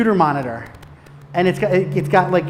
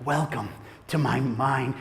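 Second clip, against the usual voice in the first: villain line; 6 semitones lower, 8 dB quieter, slower than usual. Retold or imitated. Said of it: "I'm like".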